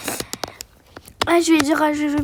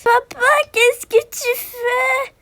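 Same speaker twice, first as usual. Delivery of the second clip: voix d'enfant aiguë et lente; petite fille apeurée; 7 semitones higher, 3 dB louder, much slower than usual. Acted. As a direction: jouer une fillette inquiète. (fillette demandant à son père ce qu'il fait)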